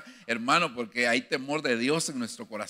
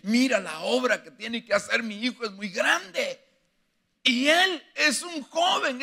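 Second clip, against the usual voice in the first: worried David praying; 8 semitones higher than usual. Retold or imitated. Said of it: "Yo estaba".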